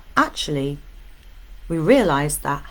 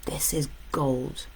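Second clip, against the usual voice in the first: slow, soft whisper; hushed emphasis; 2 semitones lower, 5 dB quieter, slower than usual. Real, not acted. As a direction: whisper it like a precious secret. Hushed emphasis on key point.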